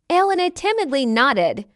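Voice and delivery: ordinary delivery, nothing marked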